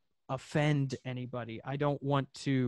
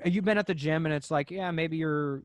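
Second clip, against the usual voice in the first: ordinary talk, nothing marked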